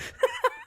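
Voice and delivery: shrill burst of laughter breaking out of the bit; a squeaky laugh at his own joke; a high laugh cracking up mid-impression; high cackle. Real, not acted.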